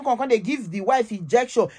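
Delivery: ordinary delivery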